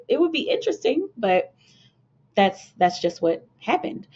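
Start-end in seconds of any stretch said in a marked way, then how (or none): 0.1-1.1 noncommittal reply. Retold or imitated. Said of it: "I was like".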